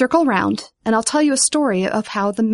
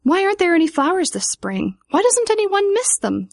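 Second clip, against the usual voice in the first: high, urgent appeal; pleading question; 9 semitones higher than usual. A narrator's reading. Vivian asking the dandelion, high and pleading. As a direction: ask it high, wanting an answer.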